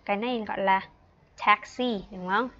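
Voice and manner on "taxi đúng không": normal talking voice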